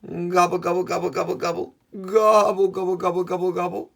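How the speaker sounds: high, rattled-off gobbling; turkey gobble. Pretend. Showing kids what turkeys say, high repeated gobbling. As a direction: imitate a turkey in rapid runs. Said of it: "The turkey says".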